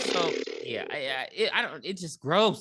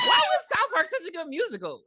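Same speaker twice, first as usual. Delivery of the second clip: excited outburst; 14 semitones higher than usual. Real, not acted.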